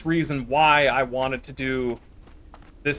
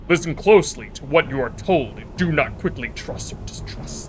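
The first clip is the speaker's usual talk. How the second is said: put-on orc warrior voice. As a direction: read it like an orc chieftain. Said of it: "Let me put on my orc voice".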